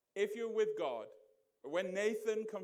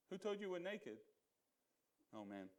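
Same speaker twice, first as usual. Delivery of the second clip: ordinary delivery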